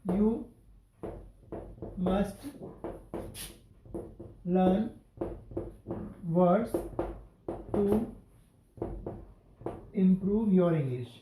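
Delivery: each word set apart with long pauses; slow dictation. Shown as a demonstration. Teacher dictating an example sentence to students, slow word by word. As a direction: dictate it to the class one word at a time. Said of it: "अब कैसे?".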